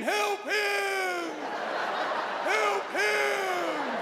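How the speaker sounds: high, long-drawn howl; howling dogs. Acted. Neighborhood dogs joining in from afar, howling. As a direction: howl the words like distant dogs. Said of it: "hear him in the distance and join in".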